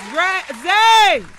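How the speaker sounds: sudden high, loud holler; real yelling. Real, not acted. Host calling Zay to play music, yelling. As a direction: break off and shout the name. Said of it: "I just like yelling".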